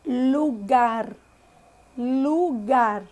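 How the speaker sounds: lugar with a pure u and a tapped final r; Spanish lugar. Shown as a demonstration. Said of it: "não muda a pronúncia da vogal".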